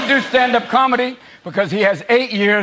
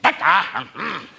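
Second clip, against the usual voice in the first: lost, trailing mumble; a low, confused old-man mumble; confused McCain; 3 semitones lower than usual. Retold or imitated. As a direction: drift off mid-word. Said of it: "McCain was just like".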